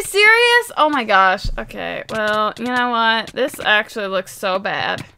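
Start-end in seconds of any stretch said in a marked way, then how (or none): none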